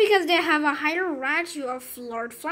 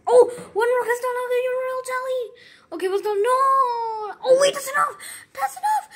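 much higher, louder squealing with a laugh; excited squeal; 8 semitones higher, 5 dB louder than usual. Real, not acted. Thrilled by a lucky roll in the game, squealing. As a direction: shriek with delight at the lucky result.

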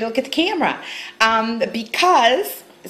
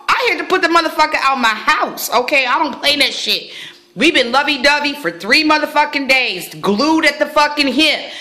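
usual speaking voice

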